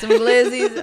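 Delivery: giggling through the words; laughing. Real, not acted. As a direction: laugh it out warmly. Laughing at glizzies.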